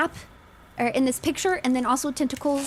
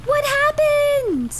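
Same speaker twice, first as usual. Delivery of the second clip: high, slow, drawn-out falsetto, louder; stretched falsetto daze; 7 dB louder, much slower than usual. Pretend. Dazed after lighthouse goes out.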